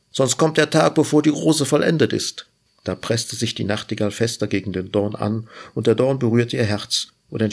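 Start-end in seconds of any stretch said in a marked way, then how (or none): 0.1-2.5 urgent call. A narrator's reading. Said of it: "rief der Strauch".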